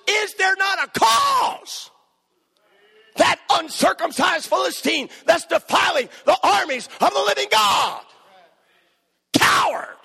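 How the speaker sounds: rally the army with outrage; defiant shout. Acted.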